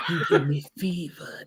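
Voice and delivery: sultry auntie voice. Retold or imitated. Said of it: "she sound like... like an auntie".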